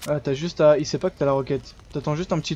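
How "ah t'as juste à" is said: usual speaking voice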